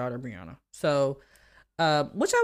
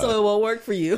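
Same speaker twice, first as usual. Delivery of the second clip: louder, higher laughing quote; 6 semitones higher, 6 dB louder than usual. Retold or imitated. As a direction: laugh through the line. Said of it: "he's like".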